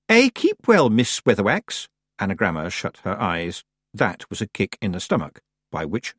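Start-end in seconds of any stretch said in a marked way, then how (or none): 0.1-1.9 high, formal grand lady; chilly politeness. A narrator's reading. Missus Earwig returns Granny's greeting, chilly politeness. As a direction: gush politely while meaning none of it. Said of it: "formal and chilly way".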